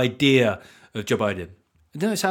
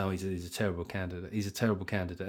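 grumbling voters; 5 semitones lower, 12 dB quieter, faster than usual. Retold or imitated. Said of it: "people say".

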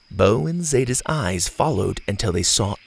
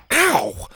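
sharp ow. Acted. Bo hit by a shoe.

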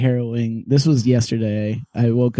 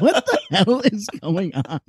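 laughing; 8 semitones higher than usual. Real, not acted. Confused, laughing at scene.

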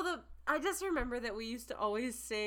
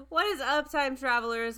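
hype greeting; 4 semitones higher, 8 dB louder than usual. Real, not acted. Podcast host greeting, loud.

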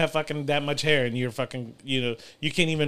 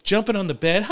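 ordinary talk

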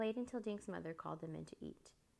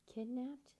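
higher, startled cry of disbelief; raised, alarmed echo; shocked yell; 7 semitones higher, 3 dB louder than usual. Acted. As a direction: blurt it out in shock. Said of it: "the three other kids yelled".

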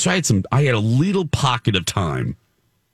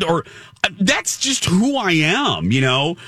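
ordinary talk, nothing marked

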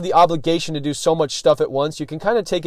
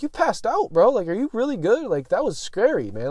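scared concern; 7 semitones higher than usual. Retold or imitated. To the friend who passed out, high and anxious. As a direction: pitch up with nervous worry.

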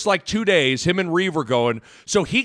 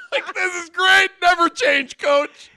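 laughing; 13 semitones higher, 4 dB louder, slower than usual. Real, not acted. Laughing while retelling.

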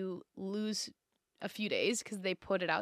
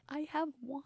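mocking a friend's hushed admission; soft, higher, furtive mimicry; imitated whisper; 4 semitones higher, 7 dB quieter than usual. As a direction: mimic a half-whispered confession. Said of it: "You also like whispering".

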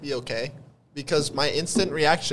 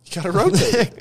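excited echo; 7 semitones higher, 6 dB louder than usual. Real, not acted. Echoing the joke line.

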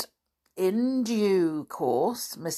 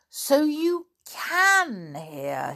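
high, slow and drawn-out discovery; accusing astonishment; 10 semitones higher, 4 dB louder, much slower than usual. A narrator's reading. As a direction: stretch it out in indignant surprise. Boy exposes servant's fake deafness.

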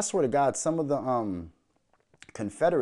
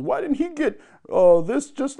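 mock reporter question; 13 semitones higher, 7 dB louder than usual.

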